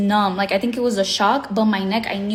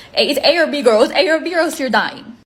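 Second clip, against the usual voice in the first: urgent self-talk; 4 semitones higher, 5 dB louder, faster than usual. Retold or imitated. Urgent self-talk before the impact.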